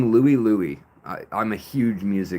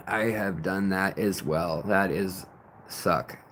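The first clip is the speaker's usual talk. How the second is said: ordinary talk, nothing marked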